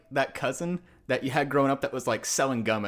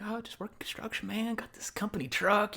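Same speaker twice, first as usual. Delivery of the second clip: higher, softer offhand brag; casual bragging; 8 semitones higher, 4 dB quieter than usual. Retold or imitated. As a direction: play it down while showing off. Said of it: "I'm, like, kinda bragging".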